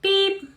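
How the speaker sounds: high mock scanner beep. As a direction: chirp it like the till. Cashier scanning.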